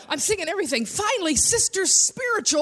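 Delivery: usual speaking voice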